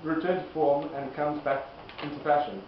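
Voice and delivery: usual speaking voice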